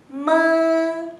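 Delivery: ma resonating in the nose; nasal ma. Shown as a demonstration. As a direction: let ma buzz through the nose. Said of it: "se escucha como sonido desde tu nariz".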